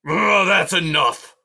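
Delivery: loud, slow, high furious porcupine; furious shout. A narrator's reading. Aerith fed up with the dripping water, furious shout. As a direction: bellow it in a rage. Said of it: "Aerith shouted with a furious shake".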